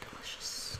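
whispered. Real, not acted. Savoring the memory of bread.

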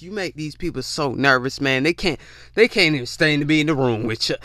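usual speaking voice

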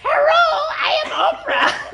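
high, loud mock accent shouted through laughter; laughing while hamming up a fake Oprah accent; silly Oprah accent. Host mocking partner's Oprah, loud laughing fake accent. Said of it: "what the fuck was that accent".

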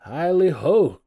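high, loud, merry whoop; jolly cry. A narrator's reading. Old master skipping down from his desk, jolly shout. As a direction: holler it out with glee. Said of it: "cried old Fezziwig".

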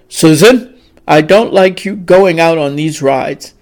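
a displeased father's stern warning. A narrator's reading. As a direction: scold her as a worried father. Frowning father scolds daughter.

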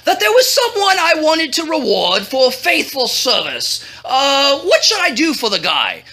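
high, swooping royal chatter; blustery, chummy king. Acted. King asking his advisor for ideas, effusive and fumbling. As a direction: swoop up high, then hem and haw. King greets and consults Haman.

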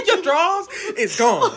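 high, loud laughing echo; laughing shriek. Real, not acted. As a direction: shriek it while cracking up. Echoing punchline laughing.

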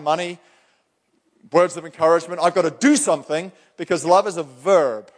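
fired-up pulpit delivery; full-force preaching. Acted. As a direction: preach it hard to the congregation.

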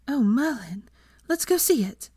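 eager and breathless; bubbling with excitement; excited. Acted. Excited bride.

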